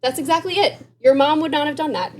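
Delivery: delighted coach saying you nailed it; excited praise. Retold or imitated. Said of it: "and I was like".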